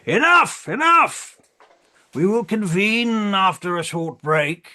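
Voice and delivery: high, loud shouts easing into announcement; calling for order. Acted. Chair calls heated council to order.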